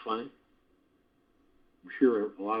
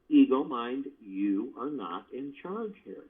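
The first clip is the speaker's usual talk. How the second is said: slow, gentle and kind, not angry; said lovingly; slower than usual. Shown as a demonstration. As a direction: lovingly tell the ego it is not in charge. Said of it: "You say it lovingly".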